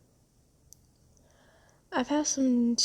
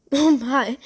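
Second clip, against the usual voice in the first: louder, startled little exclamation; taken aback; 3 semitones higher, 9 dB louder than usual. Acted. Rescuer reacting to the rescued one's misunderstanding, surprised.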